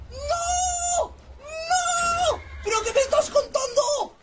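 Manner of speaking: high, drawn-out wail of protest; protesta agitada. Real, not acted. Protests at disaster in game. (protesta aguda y alargada)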